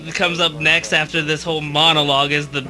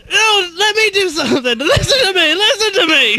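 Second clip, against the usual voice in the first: whiny Mike impression; 12 semitones higher than usual. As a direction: beg to be heard like a sulking kid. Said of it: "Mike running around".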